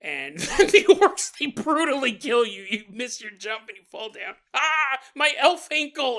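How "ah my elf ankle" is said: high, loud, slow wail; hammed-up yelp of an injured elf; mock cry of pain. Fallen elf hurting his ankle, mock pained cry.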